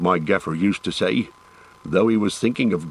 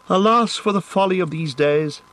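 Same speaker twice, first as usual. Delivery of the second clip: higher, lamenting elf voice; 10 semitones higher than usual. A narrator's reading. Legolas lamenting being blindfolded, higher lamenting elf. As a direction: sigh over the folly like a sad elf.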